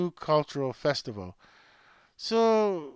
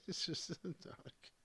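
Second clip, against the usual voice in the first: breathy aside, barely voiced; whisper; 14 dB quieter than usual. Real, not acted. Whispering about Dominic to the camera, hushed whisper.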